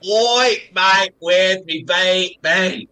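slow, hammed-up Australian accent; Australian impression. Host pretending to order a beer in Australia, mock Australian accent. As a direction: play the mock Aussie bloke ordering a beer. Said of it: "I can go up and say".

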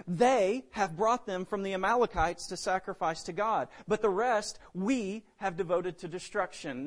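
"They" and "we" punched, standing out from the rest; stress on pronouns. Acted. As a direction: lean hard on "They" and "we". Preacher pointing out Saul's blame-shifting pronouns.

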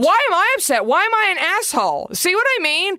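exasperated at her own pettiness; exasperated outburst. Real, not acted. Venting to a friend about her own wedding regrets, exasperated and high.

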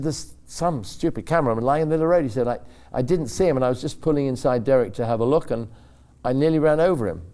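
agitated complaint. Retold or imitated. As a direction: blurt it out like you nearly hit someone. Shaken driver complaining, retold.

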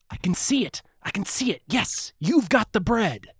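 mock-yell your approval like a buyer sold on a pitch; mock excited yell. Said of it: "And the patron would yell".

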